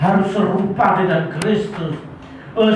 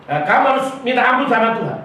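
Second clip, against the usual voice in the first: ordinary delivery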